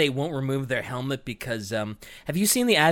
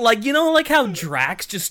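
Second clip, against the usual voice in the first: ordinary talk, nothing marked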